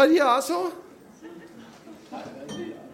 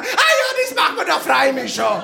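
mock chirpy reply; 5 semitones higher than usual. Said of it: "hat meine Mutter gesagt".

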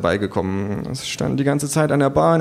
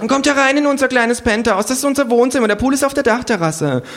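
high, loud, gushing hostess; trendy hostess; 14 semitones higher, 4 dB louder than usual. Acted. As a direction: show off the penthouse as the trendy hostess. (hohe, überschwängliche Gastgeberin)

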